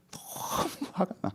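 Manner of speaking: slow, breathy mock seething. Acting out anger at the wicked prospering, seething. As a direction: squeeze the anger out under the breath. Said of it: "화내요".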